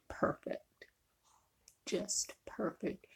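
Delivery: soft, slow whispered praise; whispering. Real, not acted. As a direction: breathe it out softly. Savoring the taste, whispered.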